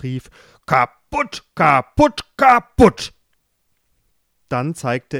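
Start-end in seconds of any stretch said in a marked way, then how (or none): 0.7-3.1 furious king, each kaputt higher and louder; building shout, higher and louder each time; angry shouting. Acted. King yelling at his daughters over ruined shoes, building furious shout. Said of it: "nahm wütend ein Schuhpaar".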